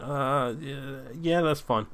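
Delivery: imitated hesitant, fumbled agreement; stumbling reply. Distracted man agreeing to let her wait, mock stumbling. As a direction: act out tripping over a reluctant yes. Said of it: "I sort of stumbled a bit on my words".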